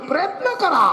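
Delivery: high-pitched punchline cry; comic exhortation. Acted. Performer urging unmarried boys to keep at it, high comic exhortation. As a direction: urge them on, pitched well up.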